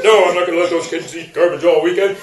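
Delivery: put-on voice, low beside the wife's; retorting ex-husband. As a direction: answer back in a low mock husband voice. Husband's reply mimicking the ex-wife.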